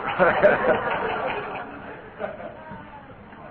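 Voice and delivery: airy rising laugh; breathy laugh. Real, not acted. Laughs at his own joke.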